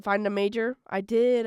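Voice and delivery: normal talking voice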